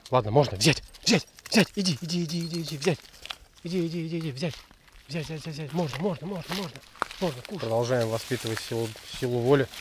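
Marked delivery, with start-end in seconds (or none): 1.0-7.6 high eager go-get-it calls; excited coaxing. Real, not acted. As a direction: urge the dog on. Разрешает есть. (высоко и радостно подзывает)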